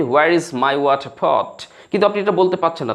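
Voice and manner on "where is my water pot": "Where is my water pot?" said very slowly, each word spelled out clearly; slow correct model. Shown as a demonstration. Teacher showing students the correct English sentence, very slow and clear. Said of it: "এর বাক্যটি বলতে হবে সঠিকভাবে যে".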